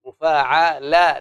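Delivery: "مفاعلة" stretched out slowly; slow scansion; slow syllable demo. Shown as a demonstration. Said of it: "مفاعلة دي".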